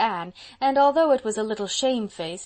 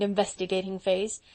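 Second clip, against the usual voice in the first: ordinary delivery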